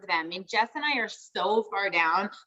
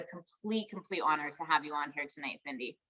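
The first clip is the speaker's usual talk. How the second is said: softer, lower tribute fading out; tender sincere; 5 semitones lower, 8 dB quieter than usual. Real, not acted. Host honouring her guest, softening to near quiet. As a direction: let it fall away gently, full of feeling.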